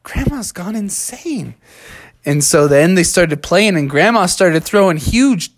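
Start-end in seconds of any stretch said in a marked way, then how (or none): 0.0-1.5 higher, softer, drawn-out disbelief; slow, hushed kid voice; astonished kids. Acted. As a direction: mutter in amazement like stunned kids. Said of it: "the kids were like".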